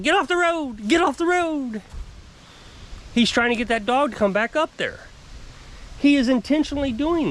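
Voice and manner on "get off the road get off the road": high mimicry of a neighbor yelling; mock yelling. Mimicking the neighbor yelling at his dog, mock yelling. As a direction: call it out high, imitating him at moderate volume. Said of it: "He starts yelling at his dog".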